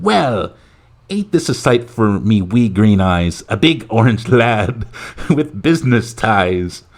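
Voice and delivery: slow, hammed-up Irish leprechaun; mock Irish brogue, words drawn out; thick Irish accent. Leprechaun greeting the president from the podium, thick Irish accent. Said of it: "in a thick Irish accent".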